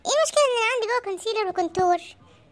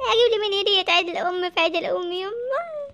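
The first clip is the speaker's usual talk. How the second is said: ordinary talk, nothing marked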